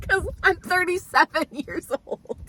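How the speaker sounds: high, breaking into laughter; laughing. Real, not acted. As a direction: say it while cracking up. Laughs at her own age.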